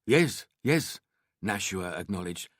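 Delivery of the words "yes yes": put-on polite shopkeeper with an Indian accent; thick Indian accent. A narrator's reading. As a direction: ask with a strong Indian accent. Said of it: "his thick Indian accent".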